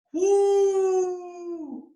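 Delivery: very high, shrill celebratory whoop. Acted. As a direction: let out a showman's whoop. Whoop after boast.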